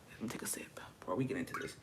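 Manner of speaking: fast whispered aside. Real, not acted. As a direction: hush it quickly before the sip. Whispered aside before a sip.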